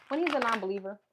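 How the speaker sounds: southern accent. Real, not acted. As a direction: slip into a southern accent on the question. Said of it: "Why did I say that in, like, a southern accent?".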